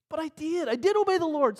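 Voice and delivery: high, loud self-defence; indignant protest. Acted. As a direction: protest loudly, pitched right up. Saul protests Samuel's accusation.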